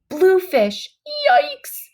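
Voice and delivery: sudden alarmed exclaim, jumping high; startled cry. A narrator's reading. Boy spotting bluefish in the bay, startled yelp. Narrator spots dangerous bluefish.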